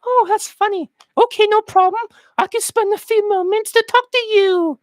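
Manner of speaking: high falsetto mock woman; agreeable woman. Woman agreeing to chat in Tesco, high mock falsetto. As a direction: accept sweetly in piping falsetto.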